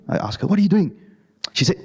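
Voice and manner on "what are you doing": alarmed question. Retold or imitated. Asks again, alarmed.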